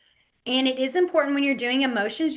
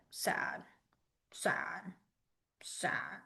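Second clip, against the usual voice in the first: whispered, drooping sad; soft whispered sadness; 4 semitones lower, 14 dB quieter, much slower than usual. Shown as a demonstration. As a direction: breathe it out like you're down. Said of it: "you're gonna go".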